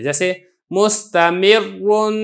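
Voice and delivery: मुस्तमिरुन read slowly with a full, heavy doubled र; slow model reading of मुस्तमिरुन with a heavy र. Shown as a demonstration. Teacher shows students how the doubled र is read full. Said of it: "रा को इस तरीके से पुर करके".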